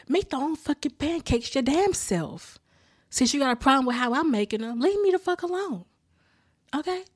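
high, fed-up retort; exasperated outburst. Acted. Fed-up vlogger telling off a meddling mother, exasperated.

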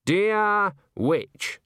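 high, measured letter-reading voice; lightly acted reading aloud. A narrator's reading. Granny opening a stranger's letter, reading aloud. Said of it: "she read".

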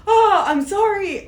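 high, loud, startled "Oh, I'm sorry"; startled apology. Retold or imitated. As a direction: blurt the apology in shock. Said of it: "And I'm like".

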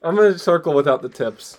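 half-yelling, a bit tipsy. Real, not acted. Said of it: "apologies for me yelling".

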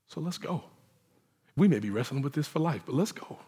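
soft, quiet and humble; a hushed, tender come along with me; gentle invitation. Retold or imitated. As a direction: invite them softly to walk along. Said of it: "He goes".

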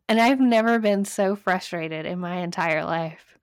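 normal talking voice

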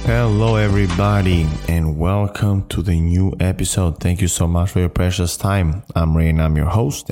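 ordinary talk

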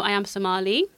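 ordinary delivery

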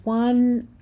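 normal talking voice

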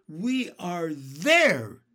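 loud, drawn-out announcement; trying cheerfulness. A narrator's reading. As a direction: proclaim it brightly and slowly to rally everyone. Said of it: "trying to be cheerful".

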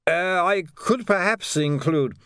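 put-on high, halting, slightly drunk voice; tipsy, dread-filled man. A narrator's reading. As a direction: offer it slowly and nervously, pitched well up. Nervous man offers another bribe.

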